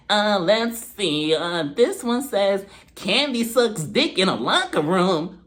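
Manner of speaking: imitated card reader. Guest reading an anonymous card to the table, slow put-on voice. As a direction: draw out each word reading a card aloud.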